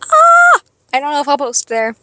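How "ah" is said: loud, very high squeal; excited squeal. Real, not acted. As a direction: let out a thrilled little scream. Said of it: "I'm so excited".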